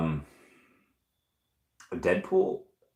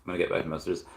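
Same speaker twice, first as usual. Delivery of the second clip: ordinary talk, nothing marked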